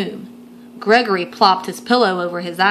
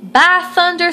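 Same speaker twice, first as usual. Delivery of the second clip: put-on higher, louder folksy old grandpa; 9 semitones higher, 4 dB louder than usual. Grampy reading the paper, folksy old grandpa exclaiming. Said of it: "said Grampy".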